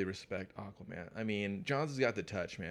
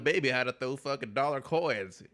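bragging Jim Lee parody; 5 semitones higher, 6 dB louder than usual. Retold or imitated.

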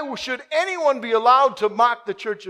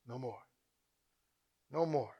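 hushed repetition; 9 semitones lower, 14 dB quieter than usual. Acted. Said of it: "he says".

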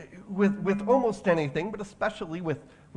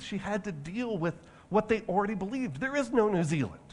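ordinary talk, nothing marked

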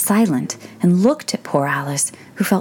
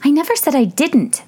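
put-on much higher, protesting girl's voice; Alice, interrupting; 11 semitones higher, 4 dB louder than usual. A narrator's reading. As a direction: cut in with a high protest. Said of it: "interrupted Alice".

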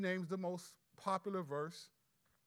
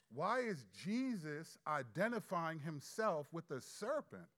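normal talking voice

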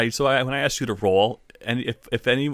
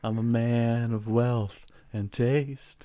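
smooth devil; 2 semitones higher, much slower than usual. Retold or imitated. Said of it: "devil would come in knowing".

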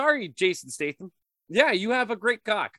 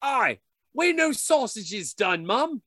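loud, higher mock Cockney British accent; 3 semitones higher, 4 dB louder than usual. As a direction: bark it like a rowdy British lad. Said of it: "probably the British version".

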